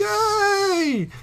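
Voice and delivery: very high squeal of delight; excited cheer. Real, not acted. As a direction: cheer like you just won. Cheering a cooking success.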